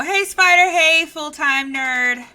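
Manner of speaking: loud, slow hype greeting; excited greeting. Acted. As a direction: greet them like a big entrance. Host greeting co-host.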